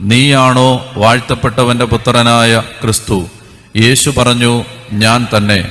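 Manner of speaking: solemn lectern reading; scripture reading. Acted. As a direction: read the Passion steadily to the congregation. Reads Mark's gospel passage.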